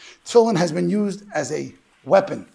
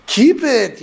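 loud, higher, firm urging to keep it; urging insistence; 6 semitones higher, 9 dB louder than usual. Retold or imitated. Storekeeper urging the grieving grandson to keep the tefillin, loud and insistent. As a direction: press him hard to hold onto the heirloom.

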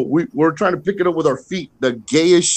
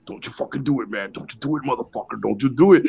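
much softer, low threat; mock menacing, kept quiet; imitated hushed warning; 5 semitones lower, 5 dB quieter, faster than usual. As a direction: warn him under your breath, repeating it. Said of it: "Joe be like".

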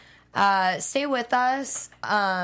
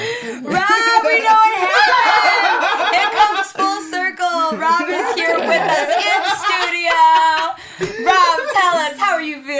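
mock host shout; 7 semitones higher, 8 dB louder than usual. Mock-interviewing co-host as Rob.

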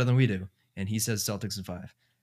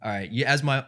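usual speaking voice

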